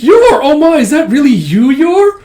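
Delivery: very high, loud happy surprise; giddy delight. Retold or imitated. Melinda recognising her friend Yor, giddy.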